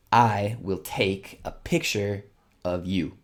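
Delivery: slow, deliberate reading of the sentence; teacher reading the exercise slowly. Shown as a demonstration. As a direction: read each word out slowly. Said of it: "let's go to the last sentence".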